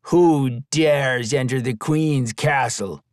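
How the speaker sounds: slow, otherworldly ghoul challenge; ghoul at the door. A narrator's reading. Door ghoul challenging intruders, slow eerie voice. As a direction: drawl it out like a door ghoul guarding the gate. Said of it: "the left ghoul hanging from the door asked".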